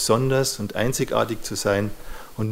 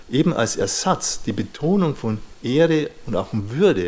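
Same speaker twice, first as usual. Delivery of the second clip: ordinary delivery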